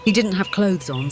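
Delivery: ordinary delivery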